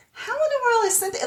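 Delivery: high, incredulous wondering aloud; puzzled viewer asking how she got there; baffled question. Retold or imitated. Viewer to herself, high incredulous disbelief. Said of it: "you're just like".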